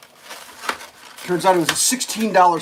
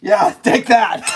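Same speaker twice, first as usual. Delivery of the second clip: loud, slow, triumphant taunt; mock vengeful shout; 4 semitones higher, 7 dB louder, slower than usual. Girlfriend punishes boyfriend with a sandwich, mock vengeful.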